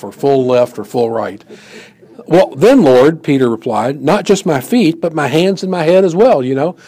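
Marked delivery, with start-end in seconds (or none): 2.3-3.2 high, loud eager outburst; eager Peter. Acted. Peter eagerly offers his whole self. 4.0-6.4 high, loud eager outburst; eager Peter. Acted. Peter eagerly offers his whole self.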